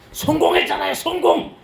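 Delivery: high, loud exultant repeat of 성공했잖아요; crowing 성공 in glee; triumphant cry. Retold or imitated. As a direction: shout 성공 like a victory. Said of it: "repeats success triumphantly".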